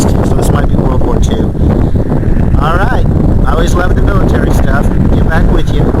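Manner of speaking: loud, projecting over wind noise; shouting over wind. Real, not acted. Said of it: "I don't know if you can hear me over this wind".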